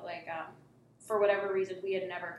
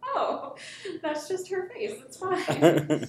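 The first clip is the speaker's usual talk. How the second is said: laughing; 11 semitones higher, 10 dB louder, much slower than usual. Retold or imitated. Laughing at her own worry.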